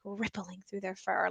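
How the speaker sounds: put-on quiet, drawn-in read aloud for the kids; soft demo. Shown as a demonstration. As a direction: hush it while the fingers ripple. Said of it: "I might take my fingers and go".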